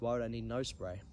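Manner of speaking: ordinary talk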